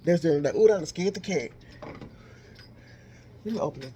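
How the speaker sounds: usual speaking voice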